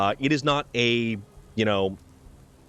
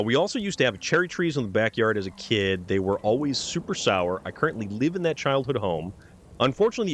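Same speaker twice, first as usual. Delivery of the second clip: ordinary delivery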